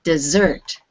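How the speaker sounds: "Dessert" with the beat on its last syllable; stress on second syllable. Shown as a demonstration. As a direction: say "Dessert" as dih-ZERT. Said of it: "with the stress on the second syllable".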